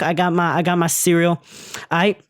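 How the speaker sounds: ordinary talk, nothing marked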